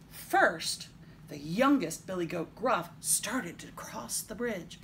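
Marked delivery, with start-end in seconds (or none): none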